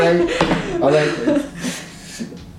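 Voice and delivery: real emotion breaking the words apart; choking up. Real, not acted. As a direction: voice cracking high with tears. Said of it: "I'm actually choking up right now".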